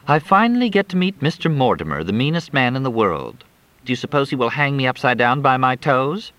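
put-on high teasing young woman's voice; Crystal.

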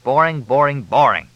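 loud, emphatic repeated jab; exasperated, punched repetition; exasperated taunt. A narrator's reading. Fletch mocks Crystal's one topic.

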